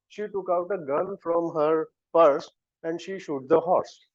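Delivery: ordinary delivery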